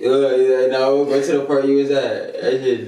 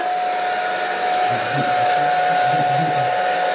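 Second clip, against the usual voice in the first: high laugh. Real, not acted. Loud laugh at the dryer.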